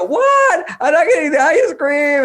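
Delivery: put-on high, loud, shocked child's wail. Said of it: "then they go".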